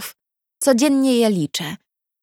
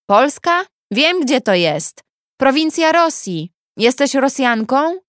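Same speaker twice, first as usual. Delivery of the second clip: brash cellmate Hawa; 6 semitones higher than usual. Acted. Hawa calls through the wall to Iza, high and cocky. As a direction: tease her as a know-it-all neighbour.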